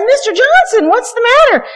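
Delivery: high, alarmed nurse calling after him; puzzled nurse calling. Retold or imitated. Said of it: "She says".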